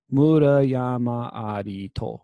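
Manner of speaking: very slow, each syllable stretched; drawn-out Japanese recitation; syllable-by-syllable demo. Shown as a demonstration. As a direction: sound out the line for counting syllables. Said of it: "seven syllables".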